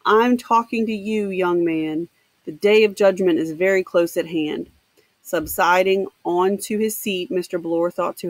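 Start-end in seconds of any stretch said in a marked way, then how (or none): none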